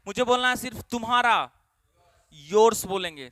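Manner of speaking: ordinary talk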